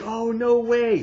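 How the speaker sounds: high, drawn-out disbelief; slow dismay. Retold or imitated. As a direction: stretch it out in slow horror. Viewer seeing the baby die.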